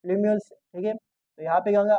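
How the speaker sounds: normal talking voice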